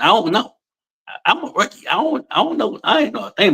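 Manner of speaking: high, playful I-don't-know; mock clueless rookie. Rookie youtuber joking to the interviewer, high mock innocence. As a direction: squeak it out like a clueless newbie.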